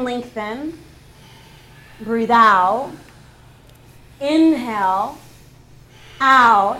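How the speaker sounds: yoga instructor leading a flow; called-out yoga cues. Acted. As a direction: project each cue across the studio. Yoga teacher calling out cues.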